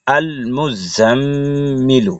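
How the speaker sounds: আল মুজাম্মিলু very slow, long hummed mm; nasal ghunnah. Shown as a demonstration. Teacher reciting the whole word, slow nasal ghunnah. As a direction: stretch the mm of মুজাম্মিলু through the nose. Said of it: "ওয়াজিব গুন্না".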